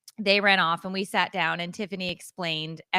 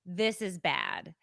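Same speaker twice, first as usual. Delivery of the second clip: worried mutter; 3 semitones lower, 5 dB quieter, slower than usual. Retold or imitated. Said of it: "my sphincter starts to pucker".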